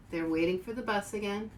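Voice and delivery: ordinary delivery, nothing marked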